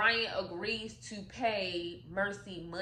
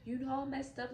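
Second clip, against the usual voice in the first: imitating pleading; 6 semitones higher than usual. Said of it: "Brian is crying and begging her".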